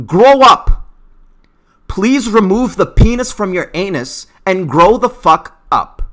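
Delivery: loud, high exasperated rebuke; angry scolding. Real, not acted. Vlogger telling offended people to grow up, angry.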